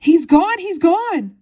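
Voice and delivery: very high, loud shriek; hysterical scream. A narrator's reading. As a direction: scream it out in horror. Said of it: "and she screamed".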